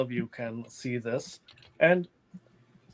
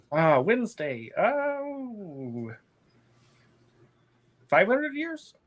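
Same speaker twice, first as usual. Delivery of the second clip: put-on high, startled old crone; lightly voiced surprised hag; very old woman; 8 semitones higher, much slower than usual.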